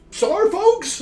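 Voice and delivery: high loud flustered yelp; sudden flustered cry; startled yelp. Acted. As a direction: blurt it out in a panic. Barry startled, rushing off.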